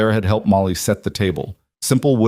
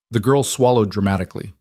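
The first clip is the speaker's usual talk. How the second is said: ordinary delivery, nothing marked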